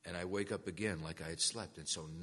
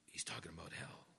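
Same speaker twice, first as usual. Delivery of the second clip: acted dread, under the breath; whispered realization; 3 semitones lower, 8 dB quieter than usual. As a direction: whisper it as a dawning thought. Retold dawning dread, whispered thought.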